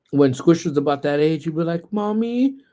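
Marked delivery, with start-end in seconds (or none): 1.9-2.6 put-on higher little-girl "Mommy"; sweet child voice; cute little voice. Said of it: "Kayla had this cute little voice".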